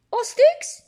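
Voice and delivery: high, loud squeaky question. Acted. Builder friend asking about sticks, high loud voice. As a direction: pipe it up.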